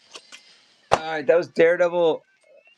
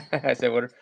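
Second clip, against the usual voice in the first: Maryland accent. Shown as a demonstration. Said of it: "Maryland's got a terrible accent".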